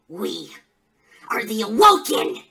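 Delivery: sudden loud battle cry; explosive villain declaration; rallying shout. Acted. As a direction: burst out with the faction's name. Promo climax, faction name.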